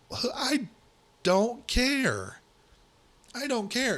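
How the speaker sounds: very high, exasperated outburst. Real, not acted. Host rejecting outback opinions on his Thanksgiving plans, exasperated. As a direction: pitch way up, each word pushed out in exasperation.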